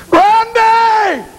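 high, drawn-out scream of a name; long wailing yell; desperate call. Acted. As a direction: call out in agony. Damned soul calls the preacher.

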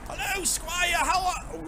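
high courtly greeting; lightly held NPC voice; posh game character. Acted. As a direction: hail the squire in a high put-on voice.

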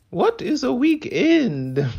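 high, loud, drawn-out theatrical question; slow, high-pitched hammed-up impression; mock impression. Crafter imitating a favorite actress for laughs, hammed-up impression. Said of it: "That was an impression. I do impressions".

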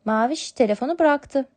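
plain reading voice

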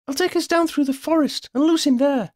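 high-pitched shout over the din; urgent, high-voiced shouted plan; shout over noise. A narrator's reading. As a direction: call it out high, over the roar. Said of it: "Sophie shouted over the noise".

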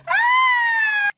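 piercing high, loud shriek; terrified scream. Acted. Caperucita being attacked by the wolf, terrified scream.

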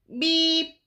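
high, short beep; little truck beep. A narrator's reading. Blue greets a big green toad, high beep. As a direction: beep like a small friendly horn. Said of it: "Blue said".

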